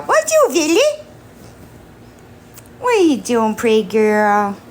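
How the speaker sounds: squeaky sing-song pet talk. Real, not acted. Baby talk to cat.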